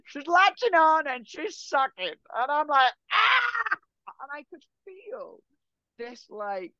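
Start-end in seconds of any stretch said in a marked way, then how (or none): 3.1-3.8 yelp of pain. Retold or imitated. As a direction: let out a sharp, high startled yelp. Retelling painful sucking on neck.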